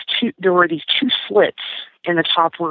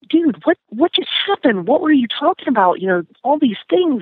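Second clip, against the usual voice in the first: bewildered outburst; 8 semitones higher than usual. Retold or imitated. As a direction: demand answers in disbelief, pitch way up. Confused teller demanding answers.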